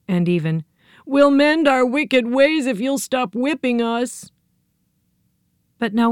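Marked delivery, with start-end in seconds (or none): 1.1-4.3 high, slow, pleading whine. A narrator's reading. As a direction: beg in a thin high voice. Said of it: "a piteous wail arose".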